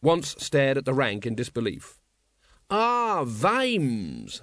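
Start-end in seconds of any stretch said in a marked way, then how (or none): 2.7-4.4 languid, swooping greeting; unruffled ruler. Acted. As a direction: greet him smoothly, drawing out the name. Patrician greets his captain.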